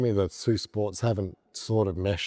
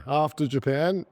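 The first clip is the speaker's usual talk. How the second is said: ordinary talk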